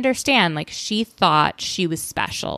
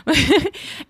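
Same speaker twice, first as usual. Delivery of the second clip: quick amused laugh; a bright burst of laughter; short laugh; 10 semitones higher than usual. Real, not acted. Laughs at own remark.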